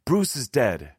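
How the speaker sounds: sudden urgent outburst; bursting out. A narrator's reading. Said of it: "I burst out".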